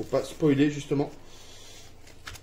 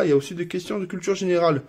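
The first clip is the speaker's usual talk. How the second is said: usual speaking voice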